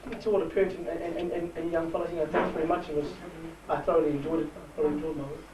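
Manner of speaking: ordinary talk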